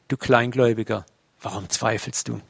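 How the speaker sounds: Jesus softly chiding Petrus as he sinks; gentle rebuke. Acted.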